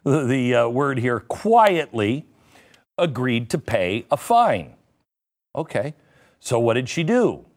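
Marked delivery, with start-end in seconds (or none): 1.2-2.3 quietly stretched slow with a rising tune; sarcastic stress on quietly. Shown as a demonstration. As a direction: lean hard into quietly, drawn out and rising. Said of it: "I want to emphasize on the word here, quietly".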